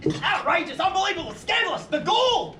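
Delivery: high-pitched, climbing indignation; outraged. Acted. Uncle reacting to the burial request, outraged.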